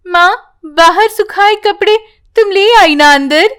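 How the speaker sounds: put-on a child checking up on her mother; young girl voice. Priya asks mother. (ऊँची बच्ची की आवाज़ में सवाल)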